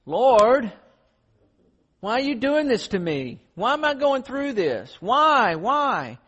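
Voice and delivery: high, loud, wailing why; whining complaint to God. Retold or imitated. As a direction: cry out to the Lord in self-pity. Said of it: "when you go through the hard times".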